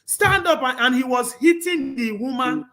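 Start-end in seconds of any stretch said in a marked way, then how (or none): 0.1-0.6 a higher, louder mock yell of the killer; retold order barked higher and louder; imitated shout. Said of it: "you know, shouting".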